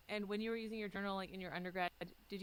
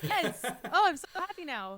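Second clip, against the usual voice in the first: high, loud burst of delight; thrilled recognition, voice shooting up; squealing with joy at the news; excited; 8 semitones higher, 11 dB louder, slower than usual. Real, not acted. Delighted about shared Alberta roots.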